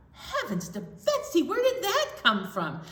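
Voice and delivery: high-pitched, startled mock alarm; startled exclamation. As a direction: react as if startled by a gust. Acted startled reaction to wind.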